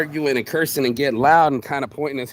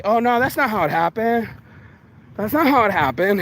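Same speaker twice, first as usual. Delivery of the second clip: mild mock denial; 6 semitones higher than usual.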